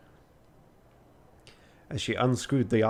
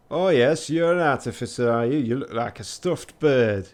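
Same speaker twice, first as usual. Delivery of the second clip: irate Yorkshire artificer; 4 dB louder than usual. Acted. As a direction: scoff in an attempted Yorkshire accent. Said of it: "her irate tone and her masculine".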